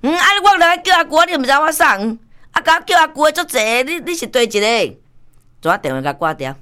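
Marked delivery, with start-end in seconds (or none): 0.0-5.0 acted phone exchange. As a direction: replay the phone call, pitched way up. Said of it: "你送你甲我讲啊". (高声演出电话对话)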